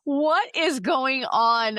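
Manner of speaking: high, drawn-out baffled what. Retold or imitated. Reacting to a weird video, high and slow in confusion. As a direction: act out being totally lost. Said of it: "I was like".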